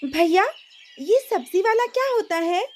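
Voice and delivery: a small sister full of questions; little girl. Acted.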